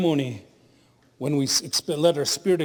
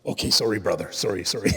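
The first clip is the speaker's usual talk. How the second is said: apologetic other driver; 8 semitones lower than usual. Retold or imitated. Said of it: "And he goes".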